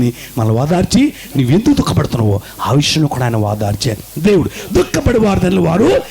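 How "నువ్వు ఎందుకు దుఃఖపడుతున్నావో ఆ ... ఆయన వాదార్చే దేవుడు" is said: ordinary talk, nothing marked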